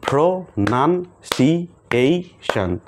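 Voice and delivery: প্রোনাউন্সিয়েশন slow in five beats, pro-naun-si-ei-shon; slow clapped syllables. Shown as a demonstration. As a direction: clap five times, slowly: প্রোনাউন্সিয়েশন. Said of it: "এখানে কয়টা আছে?".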